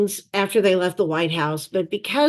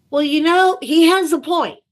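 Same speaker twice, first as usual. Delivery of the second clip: amused; 8 semitones higher, 5 dB louder than usual. Real, not acted. Amused aside after the punchline.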